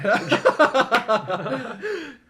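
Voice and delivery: high shrieking laugh; loud laughter. Real, not acted. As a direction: burst out laughing. Big laugh at joke.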